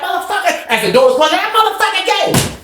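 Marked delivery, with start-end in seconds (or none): 0.0-0.7 very high, thin outraged cry; high-pitched retold holler; heard through closing door. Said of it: "That's how you heard before the door closed". 1.4-2.6 very high, thin outraged cry; high-pitched retold holler; heard through closing door. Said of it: "That's how you heard before the door closed".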